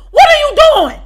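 high, loud furious yell. Retold or imitated. Catching a woman stirring her pot, high loud yell. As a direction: yell it at the intruder. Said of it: "So I get to yelling".

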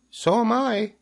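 very high little voice; tiny voice. A narrator's reading. Second spider tells Wilbur she's here, tiny voice. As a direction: pipe up in a tiny voice. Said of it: "said another tiny voice".

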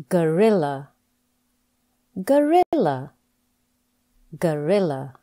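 each word spoken slowly and clearly, with a falling end; a teacher's slow, clear word-by-word drill; careful pronunciation drill. Shown as a demonstration. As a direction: model every word distinctly for the learner to repeat. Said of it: "Practice these words with me to pronounce the words properly".

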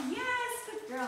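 high praise for the dog; excited praise. Real, not acted. Trainer praising puppy's retrieve, excited. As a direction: reward her with your voice. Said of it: "keep your voice excited".

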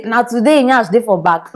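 ordinary talk

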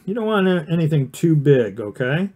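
usual speaking voice

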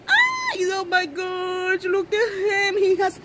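high, slow, teary gushing; happy tears. Real, not acted. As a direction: voice breaking with joy. Crying with joy at the baby.

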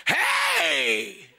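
long, high mock holler; booming shout. Preacher mimicking the God people wait for, shouting. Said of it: "waiting for God to say".

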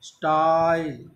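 British "स्टाइल", slow, with a stressed आ; British English "स्टाइल", the ई kept light; British English "style". Shown as a demonstration. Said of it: "आप stress रखना है". (British उच्चारण में "स्टाइल")

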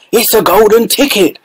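high, bursting shopkeeper shout; excited scream. A narrator's reading. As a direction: yell it out, overjoyed. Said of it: "screamed the shopkeeper".

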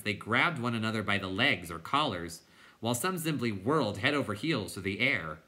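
usual speaking voice

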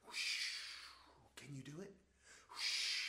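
normal talking voice

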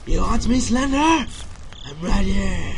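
high, loud, drawn-out taunting call. Pretend. Player taunts Slender Man, loud high drawn-out call. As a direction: dare the monster to come.

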